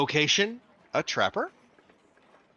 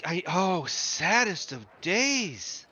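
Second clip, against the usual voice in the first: put-on higher, slow lamenting old lady; old clerk grieving a dead boy, slow and high; mournful old woman; 6 semitones higher, slower than usual. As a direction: drag out each sad line like a mourning old clerk. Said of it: "And she's like".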